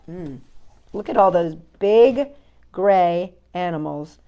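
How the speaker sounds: plain reading voice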